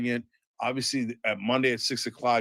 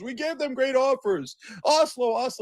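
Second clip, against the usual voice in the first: mock whiny voice; 10 semitones higher than usual. Mocking the Israelis' claims.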